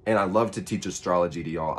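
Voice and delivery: usual speaking voice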